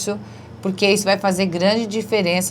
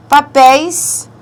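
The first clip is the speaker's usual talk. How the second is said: "Papéis" slow, higher and loud, rising; emphasized word; 7 semitones higher, 8 dB louder, slower than usual. Shown as a demonstration. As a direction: present "Papéis" with a rise. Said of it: "papéis leva acento". ("Papéis" agudo e lento)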